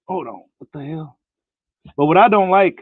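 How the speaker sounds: normal talking voice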